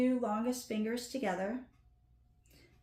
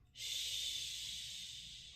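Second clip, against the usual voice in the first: a soft voiceless shh; shushing; 13 dB quieter than usual. Shown as a demonstration. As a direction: hush someone softly. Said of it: "shushing somebody".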